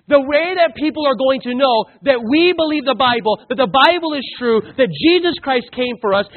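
loud, driving preacher's voice; full-force preaching. Acted. Preacher driving home his point to the church, loud and fervent. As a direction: preach it hard to the congregation.

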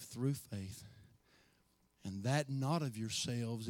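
preacher gently pressing the question of heaven; quiet earnest appeal. Real, not acted. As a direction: draw them close and speak low. Intimate low appeal.

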